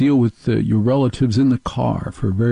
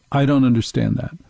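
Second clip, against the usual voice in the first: normal talking voice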